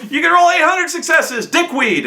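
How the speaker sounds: high, hammed-up angry outburst; exasperated retort. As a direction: re-enact an exasperated game master. Game master arguing with players.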